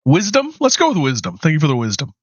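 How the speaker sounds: normal talking voice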